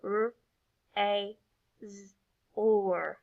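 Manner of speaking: "R, A, Z, or" each sound by itself; individual sounds. Shown as a demonstration. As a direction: isolate "R, A, Z, or" with pauses between. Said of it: "Let's try those again".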